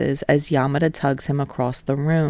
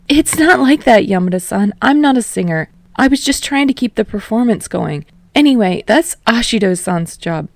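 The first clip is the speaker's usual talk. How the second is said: higher, louder, hurried denial; flustered protest; 6 semitones higher, 5 dB louder than usual. Acted. Kirishima brushing off Yamada's praise, flustered and higher. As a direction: wave off the praise in a rush.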